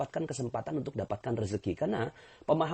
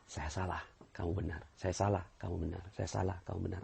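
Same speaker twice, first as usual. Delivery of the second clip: low, quiet and humble, giving in; a preacher showing the congregation how to give in gently; soft humble admission; 6 semitones lower, 8 dB quieter, faster than usual. Shown as a demonstration. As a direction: say it softly, like letting the argument go. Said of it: "Sekarang kita balik".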